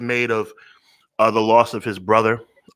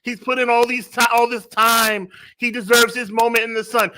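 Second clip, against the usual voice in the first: mocking imitation; 10 semitones higher than usual. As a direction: mimic a gushing sympathizer with scorn. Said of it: "You know why I don't talk like that?".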